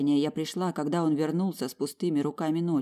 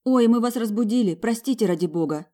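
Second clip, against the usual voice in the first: higher, louder flustered apology; lamenting; 7 semitones higher, 4 dB louder than usual. A narrator's reading. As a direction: fuss and apologise. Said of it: "начала причитать".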